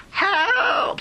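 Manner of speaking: high, loud crying plea; drowning boy's last scream; desperate cry. Acted. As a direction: wail for help.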